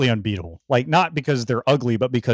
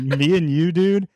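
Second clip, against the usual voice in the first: rising mock bro voice, dude higher; 5 semitones higher, slower than usual. To a teenager, a rising mock bro tone. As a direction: play the chummy older guy. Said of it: "just being like".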